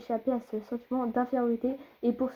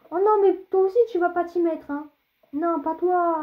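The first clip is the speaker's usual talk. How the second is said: exasperated whine; 6 semitones higher, 7 dB louder than usual. Real, not acted. Complains about long character text.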